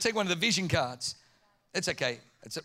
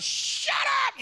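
very high, yelled; exasperated shout; 3 dB louder than usual. Retold or imitated. Dad yelling at fighting kids, very high. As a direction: snap at the kids to stop. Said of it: "I'm like".

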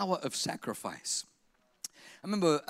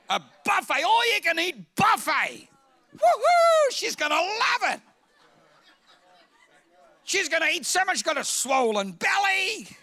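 high, loud shouting glee; excited; 9 semitones higher, 6 dB louder than usual. Retold or imitated. Pastor reliving his buffet excitement, loud and gleeful.

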